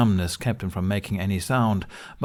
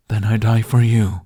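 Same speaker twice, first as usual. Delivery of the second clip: dragon's resigned reply; 7 dB louder than usual. Acted.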